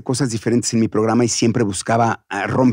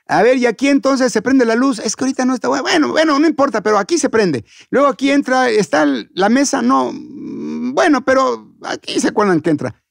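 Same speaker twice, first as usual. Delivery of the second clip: higher, casual rehearsal patter; waving problems away in a higher voice; rehearsing sloppily; 10 semitones higher than usual. Acted. Host running a loose rehearsal of their show, casual and higher. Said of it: "empiezo a ensayar como no se debe ser".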